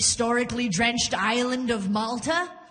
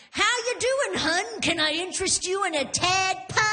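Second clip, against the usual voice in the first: high, folksy mock waitress voice; small-town waitress; 7 semitones higher than usual. Waitress greeting a customer at her table, hammed-up small-town friendliness. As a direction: chirp it high like a local server. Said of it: "when the waitress came over".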